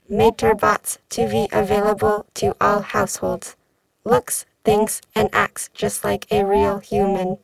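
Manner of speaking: buzzing robotic news read; even machine announcer voice. Acted. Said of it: "the robotic voice buzzed".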